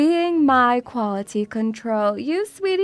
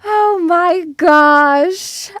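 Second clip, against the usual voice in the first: slow, stretched oh my gosh; drawn-out excited gasp; 3 semitones higher, 7 dB louder, much slower than usual. Real, not acted. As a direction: savour it, slowly and excitedly. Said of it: "look how excited I am".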